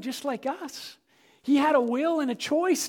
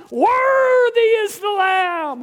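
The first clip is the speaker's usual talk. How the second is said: loud, high, slow shout of worship; shouted praise; 9 semitones higher, 9 dB louder, much slower than usual. Retold or imitated. Heaven praising the Lamb, loud slow shout. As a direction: call it out over the crowd. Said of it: "they began to shout".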